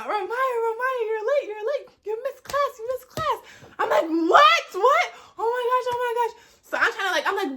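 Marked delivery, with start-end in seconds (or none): 0.0-3.4 friends' urgent voices. Retold or imitated. Friends' voices echoing in her head, high mock urgency. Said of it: "I just hear my friends in my head". 4.0-6.3 high, loud yelp easing off; mock panic on realising you overslept; panicked. Student learns she missed class, high retold panic.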